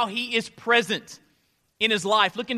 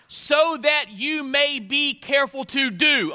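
slow, high and loud, building to "do"; stress on "do"; 7 semitones higher, 5 dB louder, slower than usual. Shown as a demonstration. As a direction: hammer each word up to "do". Verse read, "do" to be underlined.

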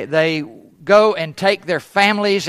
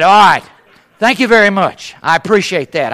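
wry thanks; 2 semitones higher, 6 dB louder than usual. Retold or imitated. Ironic thanks after insult.

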